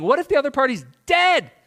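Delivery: ordinary delivery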